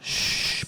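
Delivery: long, noisy sleeper's breath; exaggerated snore and exhale; pretend snoring. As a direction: breathe like a boy fast asleep. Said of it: "the little boy is sleeping in his bed".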